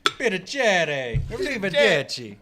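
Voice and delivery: hammed-up Italian accent. Friend dropping Italian words on the show, Italian accent. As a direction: sing out the words like a mock Italian. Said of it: "straight Italian shit with the accent".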